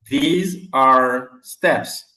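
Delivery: these are steps, very slowly, word by word; slow model. Shown as a demonstration. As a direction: model it slowly for the class to repeat. (modèle très lent : these are steps)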